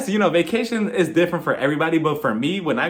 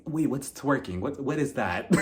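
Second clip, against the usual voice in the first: confused; 3 semitones lower, 7 dB quieter than usual. Retold or imitated. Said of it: "She was just so confused".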